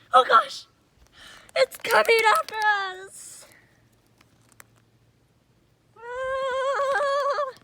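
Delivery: high, loud, slow cry of alarm; panicked alarm. Pretend. Kid playing a dog, panicking at the chasing cat. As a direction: sound the alarm as it closes in.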